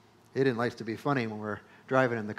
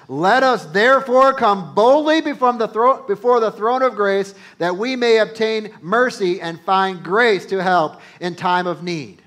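loud, higher proclaiming of scripture; preaching at full force; 7 semitones higher, 10 dB louder than usual. Acted. Preacher urging the congregation to come boldly, loud and high. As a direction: lift the verse up like a rallying cry. Preacher reads verse sixteen loudly.

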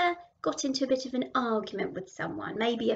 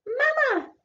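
put-on tiny high baby dragon; 12 semitones higher, 7 dB louder, slower than usual. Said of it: "said the baby dragon".

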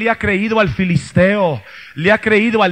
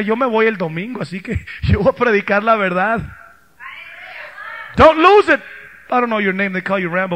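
normal talking voice

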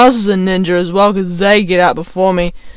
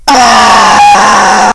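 loud, full scream; sudden burst of screaming; startled scream; 7 dB louder than usual. Acted. Kid telling story of burning bus, sudden loud scream.

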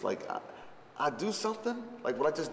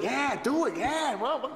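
high, loud, laughing hype; hyped-up cheering; 3 dB louder than usual. Retold or imitated. Abel hyping the rapper on set, high laughing cheer. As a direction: egg him on with laughing excitement.

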